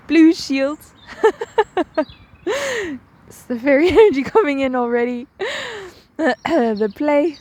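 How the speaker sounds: high, giggly burst of laughter; real giggling. Real, not acted. Guide giggling as playful fairy energy comes in, laughing. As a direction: crack up mid-sentence and talk through the giggles. Giggles interrupt her invocation.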